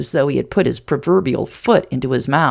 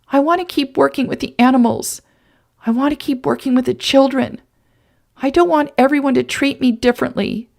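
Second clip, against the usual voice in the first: higher, teary plea; tearful pleading; 7 semitones higher than usual. Acted. Woman begging the man to keep her secret. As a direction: plead through the last tears.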